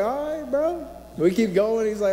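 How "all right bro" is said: much higher, resigned mock reply; grudging. To the man ordering double steak, a high grudging all right. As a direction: give in with a sigh.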